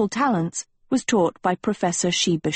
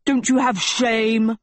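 scolding; 4 semitones higher than usual. A narrator's reading. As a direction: scold it, higher and slower. Teacher scolding the class.

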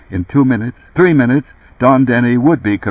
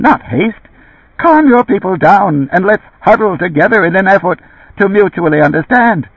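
high, squeaky mechanical insect voice; squeaking metal insect voice; 9 semitones higher, 4 dB louder than usual. A narrator's reading. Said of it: "Squeaking in his metal insect voice".

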